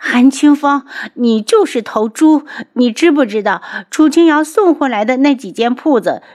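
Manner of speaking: loud, high-pitched tirade; shouting in high-pitched outrage; angry scolding. A narrator's reading. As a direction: berate them at the top of your voice. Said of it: "扯着脖子骂".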